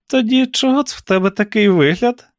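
high-pitched interrogating aunt; suspicious aunt. A narrator's reading. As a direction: challenge the niece in a high voice. Suspicious aunt questions niece's look. (високий підозріливий голос тітки)

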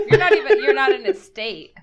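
loud, high burst through laughter; amused, laughing outburst. Real, not acted. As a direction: blurt it out while laughing. Amused teasing outburst over book.

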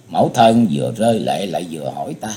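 usual speaking voice

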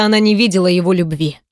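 ordinary delivery

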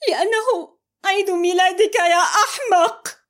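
high, loud outburst; screaming. A narrator's reading. Amal screaming the secret at Mohamed. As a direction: scream the truth at him. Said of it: "قاطعته صارخة".

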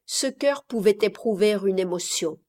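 usual speaking voice

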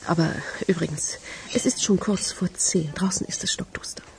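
hushed, breathy aside in the dark; whisper. Acted. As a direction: lean in and whisper it. Late-night aside to stranger.

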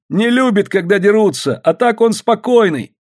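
high-pitched explaining plea; anxious pleading. A narrator's reading. Васька defending his bear to everyone, high pleading.

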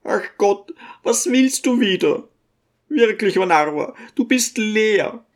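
put-on a fretting little old woman doting on her son; old mother's voice. A narrator's reading. Old mother fussing over her son to the guests, high-pitched.

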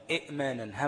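"إئمانًا" as i, glottal stop, manan; short i cut by a catch in "إئمانًا"; unvowelled hamza. Shown as a demonstration. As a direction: sound both hamzas in "إئمانًا". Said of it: "همزة ساكنة".

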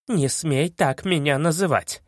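ordinary delivery

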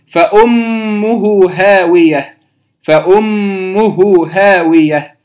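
فأمه هاوية slow, doubled M hummed; ghunna demo. Shown as a demonstration. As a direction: hold the MM of فأمه. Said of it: "أيضًا في كلمة أم الميم". (فأمه بغنة حركتين)

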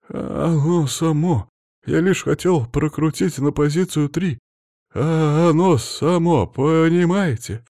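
high, drawn-out babble of a casualty; babbling injured man. A narrator's reading. Injured worker excusing himself to his rescuers, babbling. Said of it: "Непонятно залепетал пострадавший".

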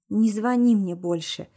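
ordinary delivery

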